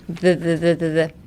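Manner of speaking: "the, the, the" as short repeated th sounds; isolated th. Shown as a demonstration. Teacher drilling the th in weather, repeated "the". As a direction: repeat "the" as separate voiced th buzzes.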